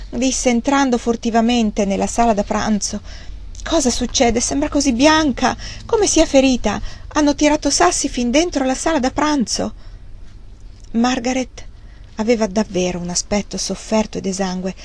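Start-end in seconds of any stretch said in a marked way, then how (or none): 3.6-9.7 high-pitched worried questions; mildly panicky, high voice; anxious questioning. Acted. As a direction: ask with mounting alarm. Fanny anxiously questions the maid.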